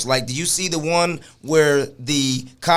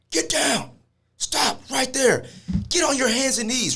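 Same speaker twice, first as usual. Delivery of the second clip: high-pitched barked cop orders, kept under full volume; police commands; 9 semitones higher, 4 dB quieter than usual. Retold or imitated. Cop ordering a man down in a hotel, high-pitched commands. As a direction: order him down in a raised, strained pitch. Acting out cop's commands at victim.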